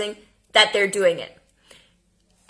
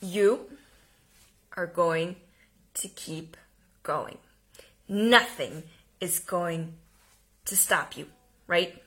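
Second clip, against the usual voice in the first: quietly certain, steady reassurance; 4 semitones lower, 6 dB quieter than usual. Shown as a demonstration. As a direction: say each word like you mean it. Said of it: "with certainty, it would sound more like this".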